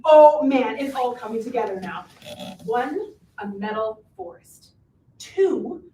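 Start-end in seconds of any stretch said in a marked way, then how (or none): 0.0-0.8 loud burst of delight; excited realisation. Real, not acted. Witch realises the plot fits together, loud and excited. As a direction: blurt it out as it clicks.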